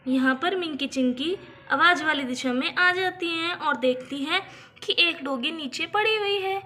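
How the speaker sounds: usual speaking voice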